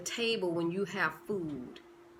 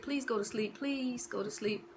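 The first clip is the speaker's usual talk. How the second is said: mock lullaby; 6 dB quieter than usual. Lulling dreams like a baby.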